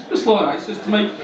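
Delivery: normal talking voice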